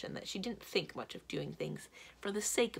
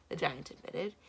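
plain reading voice

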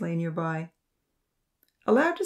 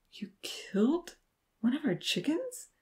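stunned disbelief; 8 semitones higher, 5 dB quieter than usual. A narrator's reading. Vahid learning a pet was killed, disbelieving. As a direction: gasp it softly, pitch rising. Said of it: "exclaimed in disbelief".

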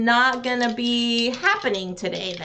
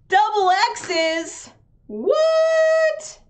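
sudden high squeak of disbelief; shocked, voice jumping way up; incredulous high yelp; surprised what; 9 semitones higher, much slower than usual. Real, not acted. Shocked at double X roll.

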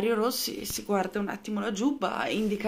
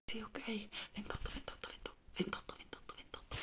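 whispered repetition; 20 dB quieter than usual. Acted. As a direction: whisper the number over and over. Soldier memorising all night.

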